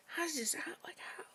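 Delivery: soft unvoiced whisper, trailing off. Shown as a demonstration. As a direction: breathe it barely audibly. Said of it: "whisper and be like".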